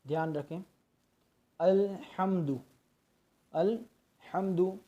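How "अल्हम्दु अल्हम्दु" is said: slow अल्हम्दु with a clear हा and silent लाम; careful pronunciation demo. Shown as a demonstration. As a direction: say अल्हम्दु slowly, letters distinct. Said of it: "हा को अच्छी तरह हमें अदा करना है". (हा साफ़ और लाम साकिन)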